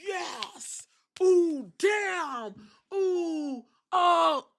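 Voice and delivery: mock hype crowd. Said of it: "They was like".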